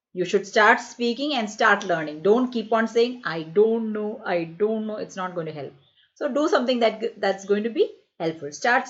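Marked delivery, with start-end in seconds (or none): none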